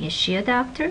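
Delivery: Is she a doctor, rising on doctor; question intonation. Shown as a demonstration. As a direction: ask it, pitch lifting on doctor. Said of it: "which naturally is a question".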